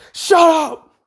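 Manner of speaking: high mock yell to silence them; exasperated yell. Heroine breaking up two bickering teammates, exasperated yell.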